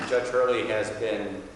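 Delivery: ordinary talk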